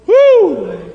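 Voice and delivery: high, loud whoop; preacher's ecstatic woo; excited whoop. Real, not acted. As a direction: shout a joyful woo.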